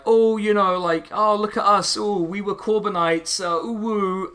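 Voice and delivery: put-on high voice, sending up the critics; mocking imitation. Mocking former Corbynites' boasting.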